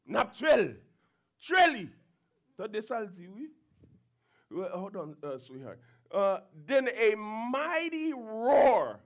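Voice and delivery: usual speaking voice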